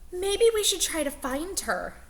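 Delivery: put-on children missing their teacher; worried kids. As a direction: suggest it hopefully.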